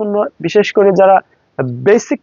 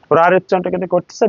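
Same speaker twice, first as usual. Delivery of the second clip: ordinary delivery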